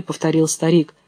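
plain reading voice

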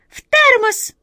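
loud, very high piping squeak; high cartoon mouse voice; squeaky mouse. A narrator's reading. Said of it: "добавила третья".

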